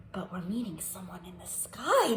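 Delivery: hushed secret whisper. Acted. Teacher revealing the wind in the sky to kids, whispered. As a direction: whisper it like a mystery.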